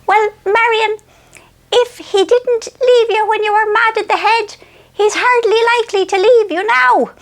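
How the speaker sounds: mother soothing Marion, high and knowing; wise old mother. Retold or imitated. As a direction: deliver it like a sage old mother soothing her daughter. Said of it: "this is how she talks".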